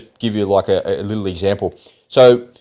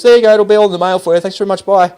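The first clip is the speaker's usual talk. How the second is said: high, fast, louder mock teller farewell; brisk teller brush-off; 8 semitones higher, 4 dB louder, faster than usual. Teller dismissing the new customer, imitated high and fast. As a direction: rattle it off like a teller hurrying you out. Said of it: "it goes".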